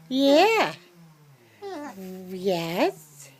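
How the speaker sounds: normal talking voice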